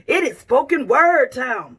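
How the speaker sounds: slow, swooping show-host call; drawn-out catchphrase. Real, not acted. Host opens spoken word night for everyone, drawn-out catchphrase. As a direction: stretch out the words like a host's catchphrase.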